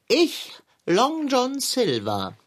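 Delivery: slow, grand self-introduction with Silver leaned on; pompous pirate-name boast, weight on Silver; stress on Silver. Acted. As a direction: announce yourself slowly and hit Silver hard. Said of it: "Betonung auf Silver".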